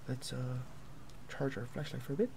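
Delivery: normal talking voice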